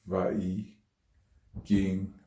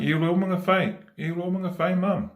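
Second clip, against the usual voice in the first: high, raised-voice reply; acted reply to mum; 11 semitones higher, 4 dB louder than usual. Child answers mum's night-time calls, high acted reply. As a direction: answer mum in a high, louder mock plea.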